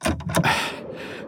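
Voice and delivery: long voiceless exhale; sigh. Acted. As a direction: sigh before complaining. Inventor with faulty transmitter.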